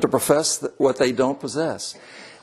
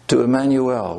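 ordinary talk, nothing marked

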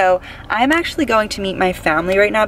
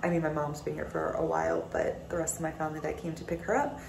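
much softer, worn-out late-night voice; tired and quiet; 3 semitones higher, 6 dB quieter than usual. Real, not acted. Exhausted vlogger talking at night after a long day, quiet. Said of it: "I am exhausted".

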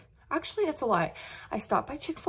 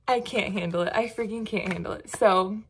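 normal talking voice

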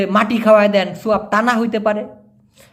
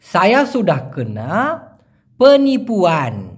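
slow demo; slower than usual. Shown as a demonstration. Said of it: "demo Malay sentence slowly".

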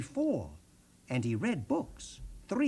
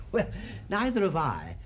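ordinary delivery, nothing marked

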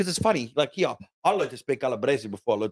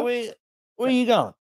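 higher-pitched mock dad questioning; exasperated dad; 9 semitones higher than usual. Dad questions his son's music plans, mock exasperated. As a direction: lightly imitate a dad challenging his child. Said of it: "And my dad goes".

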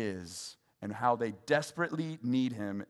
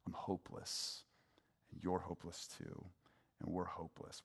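hushed despair; 3 semitones lower, 10 dB quieter than usual. Retold or imitated. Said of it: "and say".

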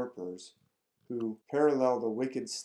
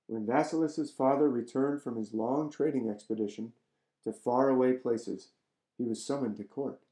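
surprised; 4 dB louder than usual. A narrator's reading. Reads a fairy tale aloud.

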